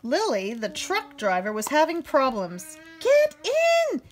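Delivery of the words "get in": much higher, louder shout. Acted. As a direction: yell at the stubborn cow. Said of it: "she shouted".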